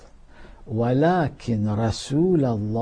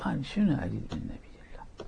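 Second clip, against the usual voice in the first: fast whispered dismissive line; whispered mock reply; 11 dB quieter, faster than usual. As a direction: mutter it off quickly under the breath. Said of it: "النبي قالها،".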